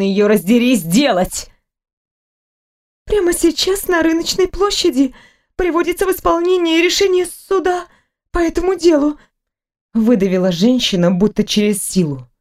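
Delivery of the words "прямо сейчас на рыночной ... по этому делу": higher, brisk talkative nurse; a fussing nurse who cannot stop talking; chatty nurse. Acted. Nurse chatters to the waking patient, higher and fussy.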